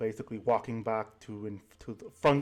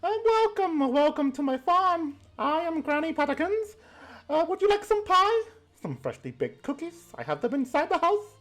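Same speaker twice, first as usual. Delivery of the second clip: put-on old granny voice. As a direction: coo like a doting grandma pinching cheeks. Granny welcomes group to farm.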